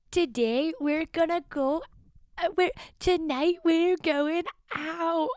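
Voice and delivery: high, drawn-out Irish-Welsh lilt; high-pitched, badly faked Irish or Welsh voice; bad Irish-Welsh accent. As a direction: imitate a TikTok lady, botched Irish-Welsh accent. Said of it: "Irish and Welsh, I can't do".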